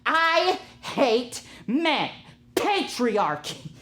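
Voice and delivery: slow, high-pitched, hammed-up outrage; mock furious rant. Imitating a livid cashier storming into the kitchen, mock fury. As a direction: stretch every word in exaggerated fury. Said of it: "just stormed into the kitchen".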